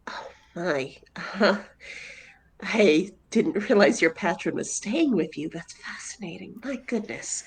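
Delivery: hushed, amazed whispering; awed whisper. Acted. Zed marvels at Ansel's patron, in an awed whisper. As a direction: whisper in wonder at the creature.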